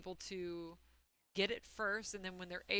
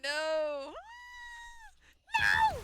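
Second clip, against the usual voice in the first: panicked screams; 10 dB louder than usual. Real, not acted. Panicking at a game monster.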